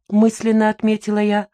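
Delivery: normal talking voice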